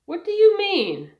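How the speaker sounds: high, loud, startled question; a sudden indignant cry of disbelief; shocked. Acted. Teacher shocked by the coach.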